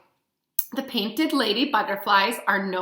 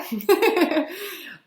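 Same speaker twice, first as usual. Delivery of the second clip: a light, high giggle at herself; sheepish giggle; embarrassed laugh; 8 semitones higher than usual. Real, not acted. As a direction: laugh off the tongue-twister. Laughing at own mispronunciation.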